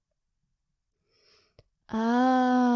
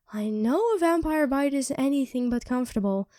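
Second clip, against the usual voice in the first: ordinary talk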